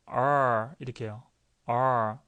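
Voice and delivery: low, drawn-out R with tongue pulled back; "R" said as if blocking the throat with the tongue; retracted English R. Shown as a demonstration. Teacher demonstrates for students, tongue-pulled-back R. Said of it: "목구멍을 막듯이 잡아당기셔야 됩니다".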